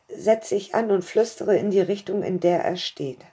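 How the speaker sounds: usual speaking voice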